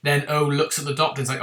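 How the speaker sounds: usual speaking voice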